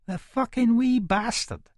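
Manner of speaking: ordinary delivery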